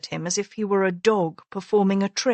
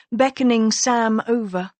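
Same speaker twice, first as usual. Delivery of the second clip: usual speaking voice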